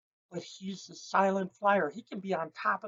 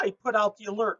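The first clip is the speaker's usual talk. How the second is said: ordinary talk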